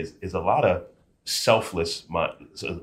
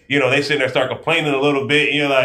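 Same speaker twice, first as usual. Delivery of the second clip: excited build; 7 semitones higher, 7 dB louder, faster than usual. Real, not acted. Excited retelling of recruits.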